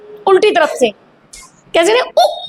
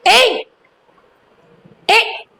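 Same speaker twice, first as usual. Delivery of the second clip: mock hushing a class; 4 semitones higher than usual. Shown as a demonstration. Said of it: "मैं class को चुप करा तो कैसी आवाज".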